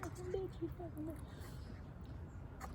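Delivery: soft, high, hissed imitation; mock muttered insult. Narrator softly mimicking the driver's repeated insult. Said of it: "she just kept".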